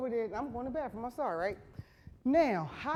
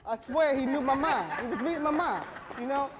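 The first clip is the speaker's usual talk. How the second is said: laughing; 5 semitones higher, 5 dB louder than usual. Real, not acted.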